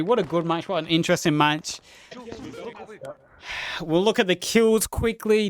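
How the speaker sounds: energetic match commentary; live play-by-play. Real, not acted. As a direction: call the action as it happens.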